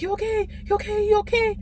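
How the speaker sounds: high-pitched anxious repeats; freaking out. Retold or imitated. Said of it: "freaking out, y'all, freaking out".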